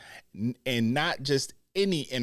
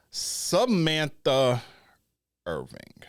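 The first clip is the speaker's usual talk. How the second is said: drawn-out name reveal; 3 semitones higher, slower than usual. Pretend. Vlogger teasing the news, drawn-out ring-style announce. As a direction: savor each syllable of Samantha.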